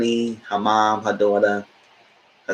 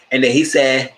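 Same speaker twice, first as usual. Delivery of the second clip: usual speaking voice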